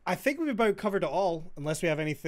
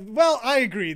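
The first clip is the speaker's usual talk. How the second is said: mock agreement; 8 semitones higher, 8 dB louder than usual. Acting out commenters making peace.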